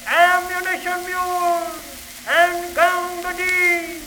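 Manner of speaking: high, far-carrying battlefield shout. Acted. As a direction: call out across the line, drawn out. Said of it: "you could hear the front file shout".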